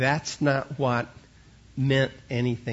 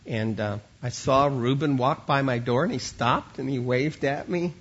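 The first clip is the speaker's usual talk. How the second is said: normal talking voice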